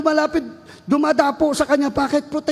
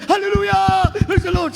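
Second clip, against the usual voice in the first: retold shout of praise; 4 semitones higher than usual. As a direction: mimic the crowd crying out when the possessed arrive. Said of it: "grabe sigaw".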